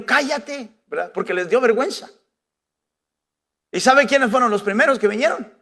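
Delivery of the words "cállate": high-pitched mock scolding; silencing. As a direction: shush him with a high, sharp order. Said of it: "todos le dijeron".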